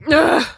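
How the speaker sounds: loud high disgusted groan. Real, not acted. After sneezing fits.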